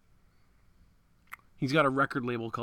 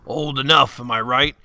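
leering, louder jokey creep voice; perverted old guy; 10 dB louder than usual. Acted. Joking to his friend as a mock creepy old guy. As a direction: ham up a sleazy old man's wink. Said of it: "a perverted old guy".

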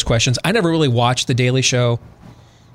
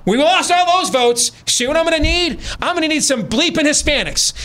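hammed-up ranting boss losing his mind; retold screaming; 12 semitones higher than usual. Candidate yelling at his dumb campaign staff, mock high-pitched scream. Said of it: "he starts screaming out".

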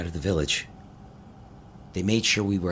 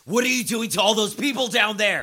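angry shouting; 13 semitones higher, 5 dB louder than usual. Acted. Confronting captors.

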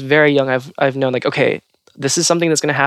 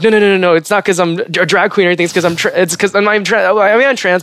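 nervous, higher-pitched rush of denials and corrections; 4 semitones higher, faster than usual. Retold or imitated. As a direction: stammer out a flustered, self-correcting excuse. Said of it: "very nervously, like, being like".

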